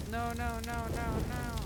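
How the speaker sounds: high, repeated mock-frightened protest; pretend panic. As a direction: play at panicking as flames catch. Figure catching fire.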